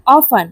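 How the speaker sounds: Often said OFF-en, t left out; silent t in often. Shown as a demonstration. Teacher repeating the model word, OFF-en with silent t. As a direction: say Often with no t sound. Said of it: "often में टी silent होता है".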